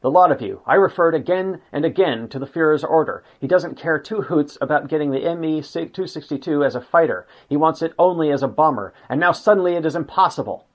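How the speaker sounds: narrated rage, forceful and exasperated; raging. A narrator's reading. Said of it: "Goering raged at the Luftwaffe engineers".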